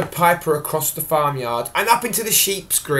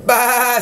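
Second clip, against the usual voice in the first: higher bleat "Bah"; lamb bleat; 7 semitones higher, 3 dB louder than usual. Acted. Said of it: "said little lamb".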